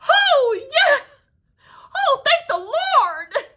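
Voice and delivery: high, loud, drawn-out relieved gush; mock overacted gratitude, high and loud; mocking Lisa Rinna. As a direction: ham up her overacted relief, high and slow. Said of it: "Lisa Rinna come in there like".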